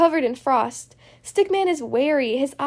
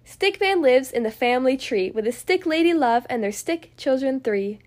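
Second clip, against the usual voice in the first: usual speaking voice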